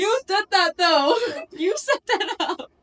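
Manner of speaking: high, loud teasing through laughter; laughing. Real, not acted. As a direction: laugh while calling her out.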